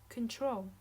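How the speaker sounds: normal talking voice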